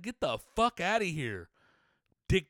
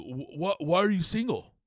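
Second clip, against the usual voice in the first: nosy questioner; 3 semitones higher, 4 dB louder than usual. Retold or imitated. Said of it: "people ask you".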